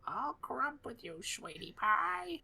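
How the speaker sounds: high, slow granny voice; old grandma voice. Acted. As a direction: croon it like a sweet granny. Dead grandma voiced in story.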